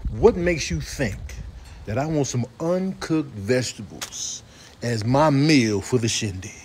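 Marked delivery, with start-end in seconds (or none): none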